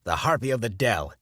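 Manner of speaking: loud accusing cry; ranting preacher. A narrator's reading. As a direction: denounce her to the whole room. Preacher denouncing Stella.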